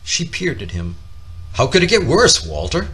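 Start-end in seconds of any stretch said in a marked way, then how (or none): 1.5-2.9 higher and louder, rising in alarm; a frightened mother's sudden cry; alarmed question. Acted. Mother cries out to her son, higher and louder in alarm. Mama startled by Walter's warning.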